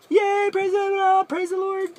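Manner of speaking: high, slow, sarcastic cheer of praise; hammed-up happy praise; mock cheering. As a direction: squeal it like fake over-the-top joy. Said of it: "She's not super excited or super awesome".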